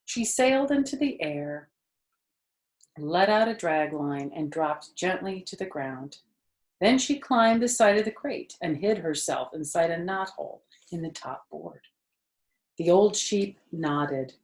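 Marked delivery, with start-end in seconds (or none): none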